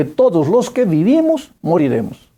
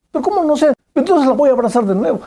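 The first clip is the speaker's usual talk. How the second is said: insistent father; 9 semitones higher, faster than usual. Retold or imitated. Father presses the girl.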